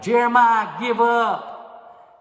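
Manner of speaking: high, loud yell of give up. Acted. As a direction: shout it down the hole at him. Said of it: "started yelling back".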